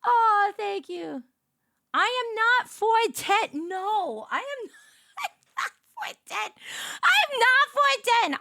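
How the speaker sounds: high, laughing indignant denial; high protest. Real, not acted. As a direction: protest the height claim, half laughing. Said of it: "reacting to chat about height".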